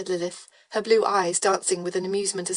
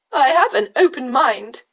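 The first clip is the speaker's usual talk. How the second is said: slow, louder beau putting on courage; brave; 5 semitones higher, 8 dB louder than usual. Acted. Beau bravely defending his opinion, hammed-up. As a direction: declare it with mock bravery. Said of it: "he said bravely".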